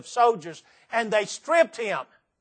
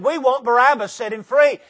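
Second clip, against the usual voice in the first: louder, higher mock crowd cry; crowd shout; 5 semitones higher, 6 dB louder than usual. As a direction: call it out like an angry mob. Crowd shouts in retold story.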